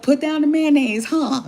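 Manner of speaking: high, loud teasing repeat; loud mock echo. Teasing the man by repeating his words loudly. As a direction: throw his line back at him, loud. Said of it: "I said".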